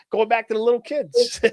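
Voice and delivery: ordinary talk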